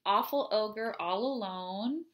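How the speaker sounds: plain reading voice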